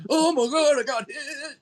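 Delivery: a high, hammy cry from a wrestler overselling a hit; mock wrestler selling. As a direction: overact taking the blow. Mocking Dusty Rhodes selling a hit.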